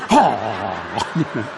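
low, dopey drawn-out oh; stupid men. Retold or imitated. Mocking men gawking at the lady, low dopey groan. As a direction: imitate dim men ogling, deep and long. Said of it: "men so stupid, you know".

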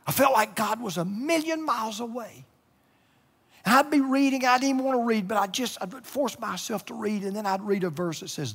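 high, strained with real emotion; close to crying. Real, not acted. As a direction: hold back tears while telling it. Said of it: "without crying".

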